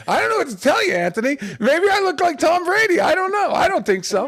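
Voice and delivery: high, loud, put-on bewilderment; loud mock protest. Prankster answering his fooled friend, loud mock innocence. Retold feigned innocence to Anthony.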